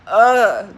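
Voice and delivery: loud groan of disgust. Real, not acted. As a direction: groan at the memory. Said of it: "makes me groan".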